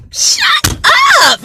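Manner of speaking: high, stretched squeal of disbelief; delighted disbelief. Retold or imitated. Learning the cashier is also in It Works, squealing high. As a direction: squeal it like you can't believe it.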